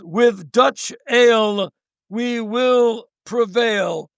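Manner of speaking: lightly mock a sloshed rock singer signing off; drunk singer's announcement. Drunk Mark closing the show with slow mock grandeur. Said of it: "he takes the mic and says".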